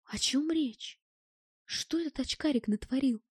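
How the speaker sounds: soft, discreet undertone; hushed whisper. A narrator's reading. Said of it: "шепнул я".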